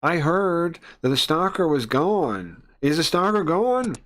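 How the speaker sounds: loud, high, building demand. Acted. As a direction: raise pitch and volume into the question. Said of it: "he's getting louder as he talks".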